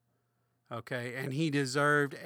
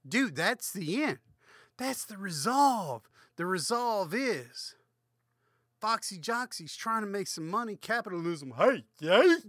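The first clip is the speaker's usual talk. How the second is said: excited; 6 semitones higher, 7 dB louder than usual. Real, not acted. Excited cheer about capitalism.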